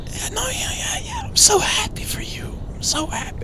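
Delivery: whispered, uncomfortable actor impression; imitated strained American accent, hushed; Tom Hardy. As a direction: mutter it awkwardly, barely voiced. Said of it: "Tom Hardy is not comfortable doing this".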